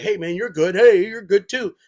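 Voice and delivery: geeky gamer voice. Retold or imitated. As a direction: play it like a nerdy online buddy. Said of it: "I sound like such a fucking".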